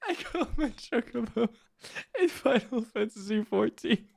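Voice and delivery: high, soft words squeezed through laughter; losing it, barely getting the words out; helpless laughter. Real, not acted. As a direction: try to say it while cracking up. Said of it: "it makes me laugh".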